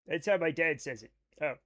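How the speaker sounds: ordinary talk, nothing marked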